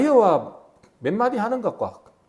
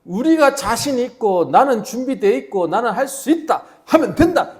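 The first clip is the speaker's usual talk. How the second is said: mock bravado; 6 semitones higher, 6 dB louder, slower than usual. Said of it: "이러고 있을 때".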